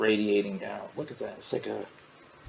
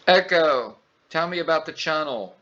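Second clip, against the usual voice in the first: loud, higher voice command; calling the assistant; 6 semitones higher, 6 dB louder than usual. Real, not acted. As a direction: call out a clear request to the assistant.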